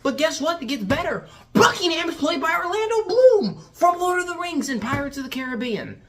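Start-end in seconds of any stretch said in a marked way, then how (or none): 1.5-6.0 high, loud excited announcement; amazed reveal. Real, not acted. Reviewer revealing who plays Buckingham, amazed outburst. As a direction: exclaim it in disbelief at the casting.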